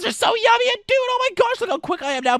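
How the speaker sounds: very high, squealed burst of boasting; hyper excitement. Pretend. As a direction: squeal it like a sugar rush. Boasting after energy drink.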